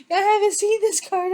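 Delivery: high, loud burst of shock; startled squeal of disbelief; surprised. Real, not acted. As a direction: blurt it out, caught off guard. Shocked reaction to tarot card.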